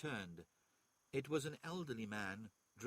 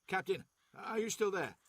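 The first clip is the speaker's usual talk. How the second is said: shouting over gunfire; 7 semitones higher, 8 dB louder than usual. A narrator's reading. Vox call over gunfire.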